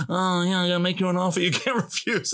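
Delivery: lightly voiced mafia don line; a hint of a Godfather impression. His taped parody playing in the classroom, lightly voiced mafia don. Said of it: "my stupid voice is playing".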